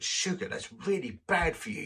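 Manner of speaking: mock high-pitched scold; scolding woman. The woman scolding the vicar about sugar, mock high-pitched. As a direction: squeak out a mock telling-off. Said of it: "she looked at me and she went".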